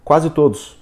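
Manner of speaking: both words run together in one quick go; in one glance. Shown as a demonstration. Teacher shows reading in one glance, as one chunk. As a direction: read QUASE TODOS as a single unit. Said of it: "num golpe de vista".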